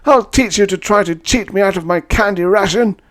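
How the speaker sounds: a crazed wreck screaming accusations; raving addict. Acted. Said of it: "He said".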